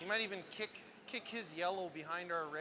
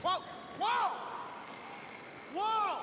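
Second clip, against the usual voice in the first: loud high whoa shouts; curling skip yelling; 12 semitones higher, 5 dB louder, much slower than usual. Real, not acted. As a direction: stop the sweepers, loud.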